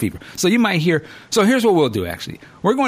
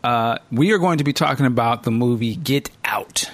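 normal talking voice

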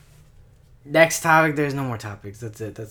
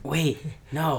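hushed voice; whispering; 3 semitones lower, 9 dB quieter than usual. Real, not acted. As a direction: quietly protest under your breath. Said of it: "Why are we whispering?".

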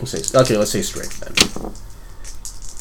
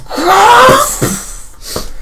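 high-pitched loud screech; attacker's cry; 4 dB louder than usual. Acted. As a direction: shriek in a high voice.

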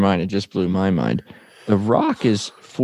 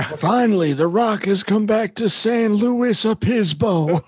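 Rock impression; 10 semitones higher than usual. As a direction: announce it like a showman. Quoting Rock's famous promo line.

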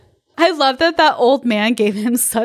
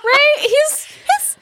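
put-on panicky old man; much slower than usual. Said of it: "I, like, imagine the old man".

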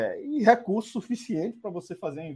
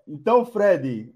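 usual speaking voice

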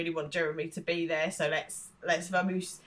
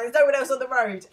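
higher, louder mock protest; mock exasperation; 6 semitones higher, 7 dB louder, faster than usual. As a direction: imitate an exasperated passenger arguing. Acted quarrel with the driver.

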